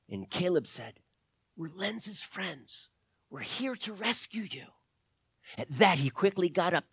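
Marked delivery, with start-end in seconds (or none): 1.6-4.7 soft, slow, secretive whisper; hushed whisper. A narrator's reading. Caleb tells the captive about the rescue, whispering. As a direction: whisper it so the camp won't hear.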